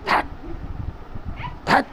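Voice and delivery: single short smacks with pauses between; imitated whack. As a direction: act out each blow as a sound. Imitates blows with the shoe.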